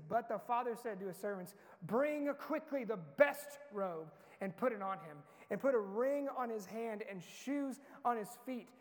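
loud, measured Bible reading with rising joy; dramatic scripture reading. Acted. Preacher reading the father's joyful orders to the church, loud and slow. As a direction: proclaim the father's feast slowly and boldly.

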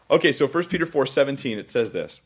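usual speaking voice

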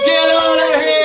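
loud, high rebuke; firm rebuke. Pretend. Preacher voicing Jesus rebuking the devil, loud. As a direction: order him out. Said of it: "The Jesus of the Bible says".

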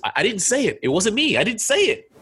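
high, flustered protest of innocence; panicked denial. Pretend. Caught by a guard after the pickup line, high and flustered. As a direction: plead you didn't do it.

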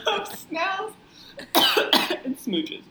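coughing through the words; words broken by a coughing fit; choking on plum. Real, not acted. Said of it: "choking on plum".

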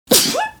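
small high sneeze; a cute little sneeze. Real, not acted. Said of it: "that was the cutest sneeze".

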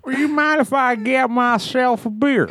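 high, slow drawling comedian voice; a slow, sing-song Cosby drawl; Bill Cosby impression. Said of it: "Bill Cosby".

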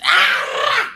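high-pitched shriek of exasperation; frustrated scream. Acted. Coach wanting to shake people awake, exasperated shriek. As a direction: burst out in a mock scream.

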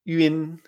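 "yun" as ü-in, held level; umlaut u. Shown as a demonstration. Teacher showing learners the ü, "yun" as ü-in. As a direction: say "yun" with i in place of e. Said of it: "Nos indica que es".

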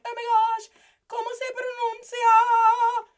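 hammed-up student freaking out over pronunciation; mock panic. Acting a panicking confused student.

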